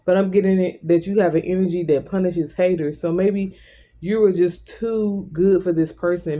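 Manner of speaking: ordinary talk, nothing marked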